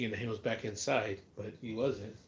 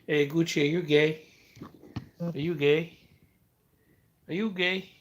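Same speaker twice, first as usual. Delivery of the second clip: loud, higher teasing question to the dog; 5 semitones higher, 9 dB louder than usual. Real, not acted. Teasing Gucci the dog with a loud, playful question. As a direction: needle him, loud and playful.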